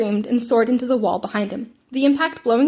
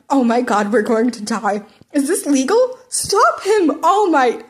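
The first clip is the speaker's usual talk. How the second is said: panicked student; 2 semitones higher, 5 dB louder, slower than usual. Acted. A panicking student begs All Might to stop the fight. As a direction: beg the teacher in a panic.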